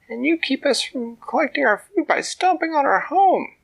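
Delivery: high, loud squeaky little ant; tiny ant voice. A narrator's reading. Ant talking to the troll from inside his ear, high squeak. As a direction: speak up like a tiny determined ant.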